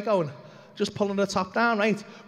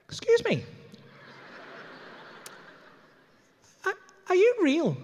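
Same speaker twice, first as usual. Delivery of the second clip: high, disbelieving question; incredulous; 8 semitones higher, 3 dB quieter than usual. Retold or imitated. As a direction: ask it in disbelief. Suspicious questioning retold.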